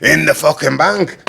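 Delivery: slow, high, shouted mock Scottish; attempted Scottish accent. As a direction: belt it out slowly in put-on Scottish. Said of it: "attempt at a Scottish accent".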